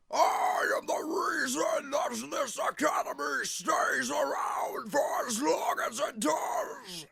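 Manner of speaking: slow, high, rasping villain roar; evil Lord Death. Acted. Voice actor testing an evil take, slow screechy menace. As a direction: strain it high and loud, dragging every word. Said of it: "evil Lord Death would sound".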